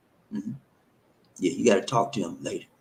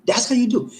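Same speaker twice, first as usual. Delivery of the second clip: playful high-pitched catchphrase; much higher repeat; 13 semitones higher, 4 dB louder than usual. Shown as a demonstration.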